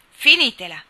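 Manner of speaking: a sharp, loud shout to stop the whistling. A narrator's reading. Papà Baer silences the boys' whistle with a loud shout. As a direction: bark it out loud, fist on the desk. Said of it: "gridò papà Baer".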